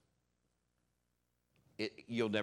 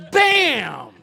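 shouted impact word with a laugh; loud bam; 19 dB louder than usual. Retold or imitated. Preacher acting out hitting the cement floor, a loud bam. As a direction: slam it out like the crash.

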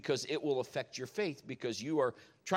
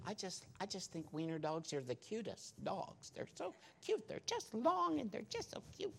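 high, breathy-free cooing wife impression, much higher and softer; mock girly voice; 6 semitones higher, 8 dB quieter than usual. Husband playfully mimicking his wife gushing over wiener dogs, high cooing voice. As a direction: gush like a smitten wife over puppies. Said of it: "she doesn't really talk like that. I'm just doing that for fun".

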